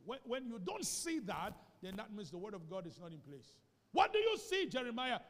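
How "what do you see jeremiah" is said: high, loud ringing question; loud calling question. Acted. God calling out to Jeremiah, loud and high. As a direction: call it out loud as God asking. God's question voiced in sermon.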